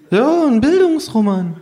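hohe, laute Figurenstimme; hoch und begeistert bekräftigen; Känguru. Acted. (hohe Känguru-Stimme)

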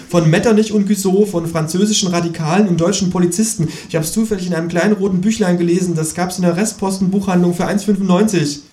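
high lecturing kangaroo voice. A narrator's reading. Kangaroo lectures on evolution in a high voice.

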